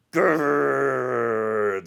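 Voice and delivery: loud playful grr growl; mock growl. Growling over his missing food, playful loud growl. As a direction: growl like an angry cartoon man. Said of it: "I'm like".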